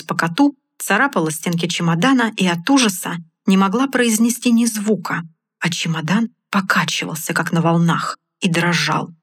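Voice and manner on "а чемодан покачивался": usual speaking voice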